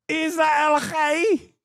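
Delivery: high, drawn-out Scottish parody cry; mock Scottish accent. Streamer still mimicking the clip's speaker, high Scottish parody. Still playing the mocked woman.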